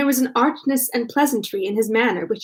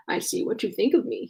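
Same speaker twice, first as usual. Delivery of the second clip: softer, understated gravity; grave; 7 dB quieter than usual. A narrator's reading. Man to his dance partner after joking, grave. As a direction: say it quietly, with mock seriousness. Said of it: "he said gravely".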